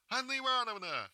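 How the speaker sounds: high, loud cry of recognition; girl recognising her teacher; joyful outcry. Acted. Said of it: "вскрикивает Маруся".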